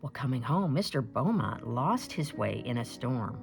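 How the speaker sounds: usual speaking voice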